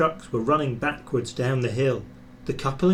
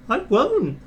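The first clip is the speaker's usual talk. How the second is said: stubborn refusal; 11 semitones higher, 5 dB louder than usual. Acted. Jeremiah refuses the guard.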